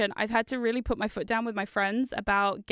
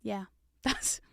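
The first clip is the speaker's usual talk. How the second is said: whisper; 3 semitones lower, 6 dB quieter than usual. Real, not acted. Whispered after long pause.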